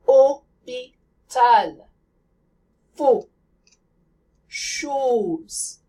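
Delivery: Mot, Parole, Hôpital with lips tightly rounded; French close O. Shown as a demonstration. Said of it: "the French O you hear in these French words".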